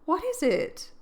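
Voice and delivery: high, eager question; eager cry. A narrator's reading. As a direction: burst out in wonder. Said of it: "cried Jo".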